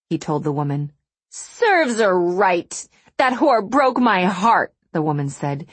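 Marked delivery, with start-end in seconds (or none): 1.3-4.7 slow, high, tearful wail; acted tearful, wounded outburst; beginning to weep. A narrator's reading. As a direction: drag it out louder, on the edge of weeping. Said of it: "beginning to weep".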